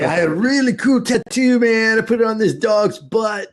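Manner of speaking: high-pitched, drawn-up boasting voice; cocky tattoo artist. Acted. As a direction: brag like an amateur tattoo artist.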